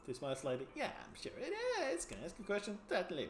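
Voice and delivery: ordinary talk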